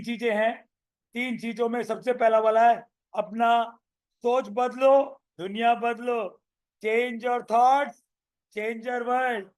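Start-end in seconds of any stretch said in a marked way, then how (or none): 3.1-9.5 drawn-out slogan, each word spaced; slow, deliberate teaching chant. Shown as a demonstration. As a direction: spell the motto out slowly for the class.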